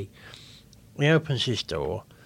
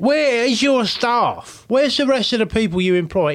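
raised pitch, heavily weighted questioning; indignant demand; 9 semitones higher than usual. Retold or imitated. Customer confronting owner about missing staff, indignant. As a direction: demand an answer, hammering each question.

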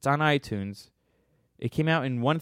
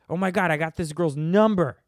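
excited; 5 semitones higher, 5 dB louder than usual. Retold or imitated. Excited eighties movie guy.

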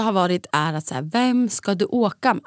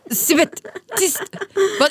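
retold irritated hushing; higher, irritated self-quote; angry retort; 11 semitones higher than usual. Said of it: "hör mig hur arg jag är".

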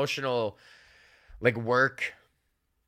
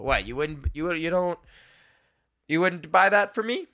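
mock offended plea; 4 semitones higher than usual. Teasing listeners to pay.

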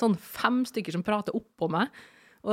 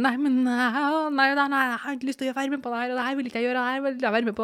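mock protest; 5 semitones higher, faster than usual. Refusing the surgery, retold.

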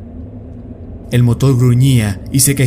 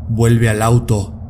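slow, firm order; commanding; slower than usual. Acted. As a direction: order it slowly and firmly. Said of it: "me ordenó Corbin".

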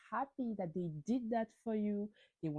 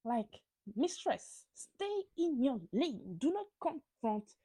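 sassy telling-off; 9 semitones higher, slower than usual. Pretend. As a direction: tell her off, slow on stay in your lane. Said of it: "and I'm like".

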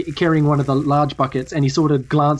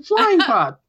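very high-pitched little squirrel. Acted. Squirrel welcomes the newcomers to Prickleton, high squirrel voice. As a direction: squeak it out as a tiny creature. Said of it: "the squirrel takes your wing and shakes it and just says".

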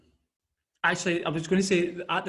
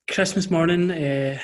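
usual speaking voice

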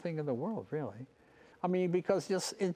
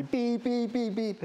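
imitated car horn; 9 semitones higher, slower than usual. Imitates horn honking behind him.

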